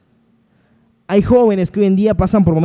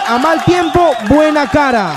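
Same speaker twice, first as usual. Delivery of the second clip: proclaiming saying; 7 semitones higher, 3 dB louder than usual. Acted. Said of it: "otro dicho que dice".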